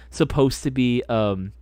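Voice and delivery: normal talking voice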